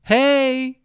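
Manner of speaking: high, loud call over the counter; shout. Acted. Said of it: "cria Sissix".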